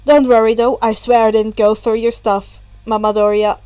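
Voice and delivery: put-on a chill older brother; casual Natsuo. A narrator's reading. Natsuo continues.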